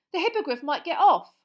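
high and loud; stout defiance. Acted. Boy defying the minister, loud and high. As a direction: say it boldly. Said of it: "said Ron stoutly".

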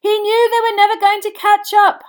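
high, loud explanation; shouting back. A narrator's reading. Boy answers his friend amid applause, shouting back. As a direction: shout over all the noise. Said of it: "Harry shouted back over all the noise".

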